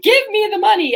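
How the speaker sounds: high, mock-bossy demand. Declaring to the universe in the car, high mock demand. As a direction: demand the money boldly. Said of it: "Or like".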